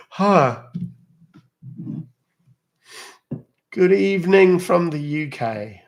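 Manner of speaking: loud, high, slow mock British greeting; mock British accent. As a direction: ham up a posh British hello from the UK. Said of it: "You love mimicking British accents".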